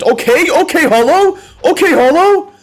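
high excited yells; excited shouting. Real, not acted. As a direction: hype it up, loud and high. Excited reaction to slot bonus.

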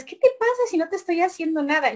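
talking to a rat. Retold or imitated. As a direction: scold a lab rat playfully, fast and high. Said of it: "Yo hablo mucho con las ratas".

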